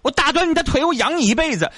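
hammed-up screaming mother threatening to break legs; mock furious mother. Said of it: "你张嘴一见着她就是".

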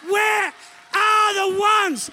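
high, slow, shouted challenge; impassioned preaching shout. Real, not acted. Preacher shouting a challenge to the church. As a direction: cry it out to the congregation, slowly. Preacher's rising appeal to crowd.

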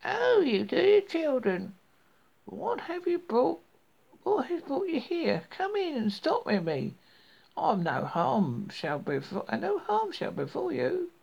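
sweet-talking witch, pitched high. A narrator's reading.

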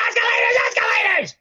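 high shouted escalators; SpongeBob shout. Retold or imitated. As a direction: cheer it like a cartoon sponge.

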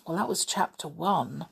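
usual speaking voice

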